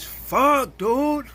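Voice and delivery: high, loud mocking yell of the coworker; coworker's outburst. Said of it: "and he says".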